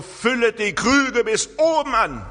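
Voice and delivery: high, loud command of Jesus; commanding order. Retold or imitated. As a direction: give it as a clear order. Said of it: "Das ist ein Imperativ. ... Das ist ein Befehl". (hoher, lauter Befehl)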